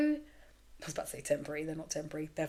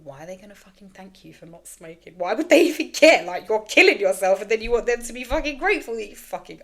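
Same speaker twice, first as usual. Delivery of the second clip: angry inner voice; 3 semitones higher, 10 dB louder than usual. Retold or imitated. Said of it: "And then I thought".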